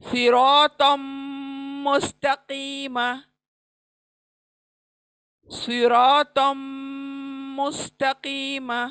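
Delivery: slow chant of सिरातम मुस्तकीमा, heavy letters; tajweed recitation. Shown as a demonstration. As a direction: recite slowly with full heavy swad and ra. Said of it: "देखिए ये पूरे मोटे आए हैं".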